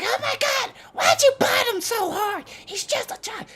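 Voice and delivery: very high, screechy mock scolding; hammed-up falsetto yell; imitated yelling aunt. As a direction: squeal it like an outraged auntie. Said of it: "Tracy starts yelling at Shannon".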